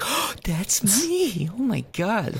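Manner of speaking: high, slow gasping realization; starstruck. Pretend. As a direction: gasp like a thrilled fan.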